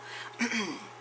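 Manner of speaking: clears her frog throat; quick throat clear. Real, not acted. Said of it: "I got my little frog voice going on".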